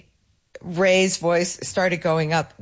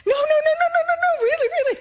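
[name] soprano, laughing; much faster than usual. Retold or imitated. Excited bassist insisting [name] walked in, laughing high falsetto. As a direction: squeal no no no in falsetto while cracking up. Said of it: "he became a soprano".

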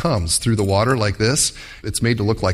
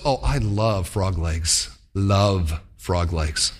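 dreamy longing; 7 semitones lower, much slower than usual. Acted. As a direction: croon it like a fish in love. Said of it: "the heart goes".

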